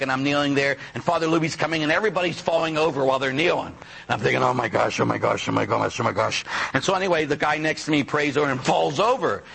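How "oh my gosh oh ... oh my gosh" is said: low, repetitive nervous muttering; mock panicky inner chant; nervous wreck. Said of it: "nervous wreck".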